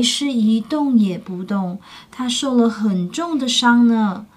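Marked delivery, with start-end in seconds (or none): none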